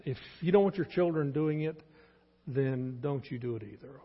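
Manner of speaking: ordinary talk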